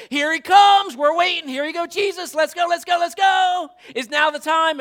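high, loud chant calling for Jesus; cheering shout. Pretend. Praise team chanting at the tomb, shouting. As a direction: whip up the crowd.

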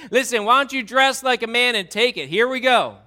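loud, high, confrontational tirade; God as tough dad. Acted. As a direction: lay down the challenge at full volume. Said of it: "I hear God saying".

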